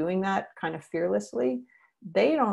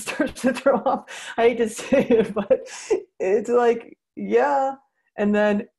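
higher and louder, laughing through it; 5 semitones higher, 5 dB louder, slower than usual. Real, not acted. Trainer cracking herself up with a throw-up comparison, laughing. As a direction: giggle at your own gross comparison.

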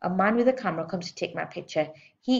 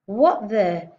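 louder angry demand; angry manager answering phone; 3 semitones higher, 7 dB louder than usual. A narrator's reading. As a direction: snap at the caller. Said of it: "he demands".

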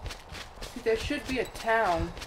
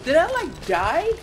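surprised; 8 semitones higher, 6 dB louder, slower than usual. Real, not acted. Confused after dying.

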